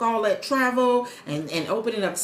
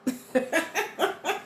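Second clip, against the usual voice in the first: high, softer laugh; real laugh; 7 semitones higher, 5 dB quieter than usual. Real, not acted. Streamer amused by her coach's accent, laughing. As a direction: chuckle high, dropping in level.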